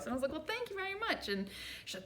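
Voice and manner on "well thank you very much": mock gracious thanks.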